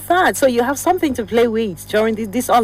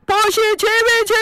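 mock scream; 12 semitones higher than usual. Shown as a demonstration. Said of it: "So I had to scream that now".

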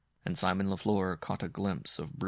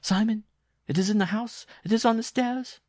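high and loud in panic; terrified cry; 7 dB louder than usual. Acted. Jean warning Simon of the thing on the stairs, panicked loud cry. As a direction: shout the alarm in terror.

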